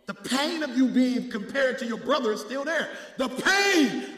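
loud, high shouted preaching; preaching at full force. Acted. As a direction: whip the church up, full voice.

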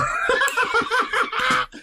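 bursts out in a high laugh; hearty laugh. Real, not acted. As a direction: laugh at your own idea. Laughs at own idea.

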